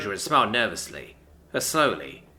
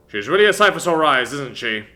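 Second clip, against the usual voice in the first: father voice; 7 dB louder than usual. Acted. Father to Greg.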